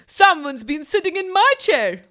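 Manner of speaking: a hint of Mother Bear, middling; lightly voiced Mother Bear; medium-sized voice. A narrator's reading. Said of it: "she said in a medium-sized voice".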